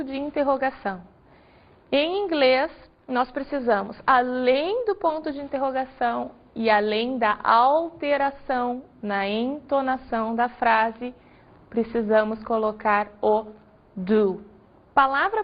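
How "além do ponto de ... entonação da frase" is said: usual speaking voice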